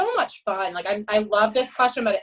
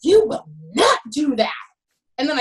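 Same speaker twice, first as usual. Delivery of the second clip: retold yell; 5 semitones higher than usual. Coach yelling at swimmer who threw his water bottle, firm. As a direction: snap it out like a coach stopping a tantrum. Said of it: "And I yell, typical [name] fashion".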